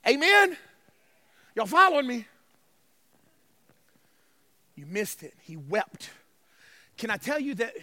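usual speaking voice

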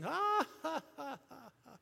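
high mocking ha-ha, quickly fading; hammed-up preacher laugh; mock preacher laughter. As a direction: imitate a preacher laughing mid-sermon. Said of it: "While he's preaching".

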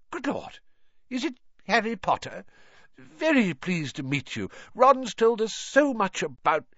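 delighted surprise. Acted.

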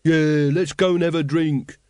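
slow, rowdy hunter voice; boisterous tavern type; rough hunter. Acted. Hunter to his mates, rowdy and slow.

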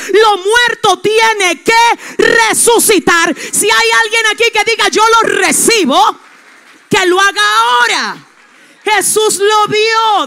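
loud, high, fervent sermon; full-force preaching. Acted. Pastor declares resurrection to the congregation, shouting.

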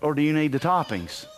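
ordinary delivery

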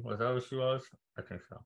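mumbled with candy in mouth; muffled words around a lozenge; mouth full. Real, not acted. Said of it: "You mumbled".

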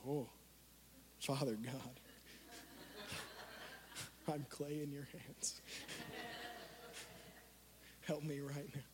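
very low, soft mock-desperate plea to God; desperate hushed prayer. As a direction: pray weakly under your breath, acting it out. Voices a desperate prayer mid-contest.

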